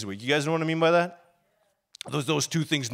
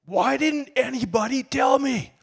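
high, loud anguished yell; retold scream of frustration from finding it in the Bible; 12 semitones higher, 8 dB louder, slower than usual. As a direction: shout it out, slow and desperate at first. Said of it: "I start yelling and screaming".

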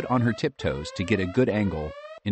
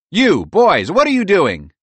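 a dad calling out sharply, louder; ringing shout; 11 semitones higher, 9 dB louder than usual. A narrator's reading. Dr. Gomez shouts at the boys from the porch. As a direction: call it out from the porch like an angry dad. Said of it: "the voice of Doctor Gomez rang out".

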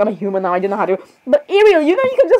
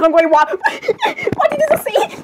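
high, giggly incredulous squeal; laughing disbelief; 13 semitones higher than usual. Real, not acted. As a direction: squeal the question through laughter.

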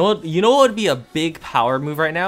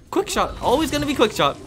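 exasperated; 9 semitones higher than usual. Real, not acted. Complaining about the same draw.

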